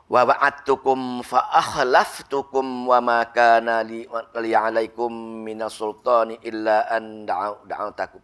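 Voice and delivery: slow, drawn-out chanted recitation; Quranic recitation. Acted. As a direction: intone the verse slowly like tilawah. Quran verse recited in sermon.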